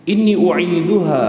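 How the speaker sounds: ringing slow Arabic recitation; Quranic recitation. Acted. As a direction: lift the verse out with force. Recites verse, louder.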